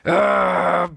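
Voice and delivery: high, loud disgusted pirate snarl; pirate captain scorning the books; pirate growl. A narrator's reading. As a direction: sneer at the word. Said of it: "growled Captain Bones".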